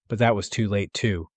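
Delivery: ordinary delivery, nothing marked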